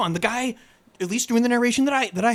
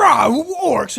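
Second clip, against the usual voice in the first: mock orc roar; 7 semitones higher, 8 dB louder than usual. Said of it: "you know, like".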